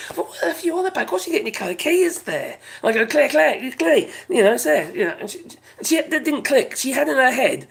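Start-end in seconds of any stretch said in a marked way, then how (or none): 3.1-4.1 calling out. Retold or imitated. Calling out to the neighbour.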